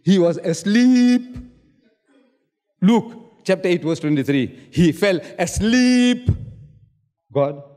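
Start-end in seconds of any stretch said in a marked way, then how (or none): 0.0-1.4 slow, loud "asleep" drawn out high; slow emphatic quote. Real, not acted. Quoting verse, mocking point. 4.7-6.3 slow, loud "asleep" drawn out high; slow emphatic quote. Real, not acted. Quoting verse, mocking point.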